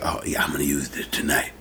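sleazy, breathy low whisper; creep voice. Acted. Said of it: "I gotta use the creep voice".

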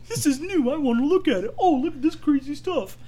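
high, loud gawking tourist; mock the oblivious park visitor; mimicking a gawking tourist; clueless tourist voice. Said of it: "They wandered through there".

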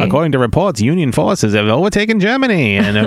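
1940s newsreel announcer voice; old newsreel. Pretend. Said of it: "like a newsreel from the nineteen forties".

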